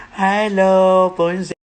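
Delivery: long, high, loud hail; calling out a greeting. Acted. Greeting a star on a birthday, calling out high and drawn-out.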